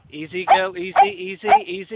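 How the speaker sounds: soothing a horse. Real, not acted. Calming the horse Tess.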